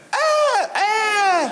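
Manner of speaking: high screaming goat yell. Retold or imitated. Comedian imitating the viral goat, high bleating scream. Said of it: "it just went".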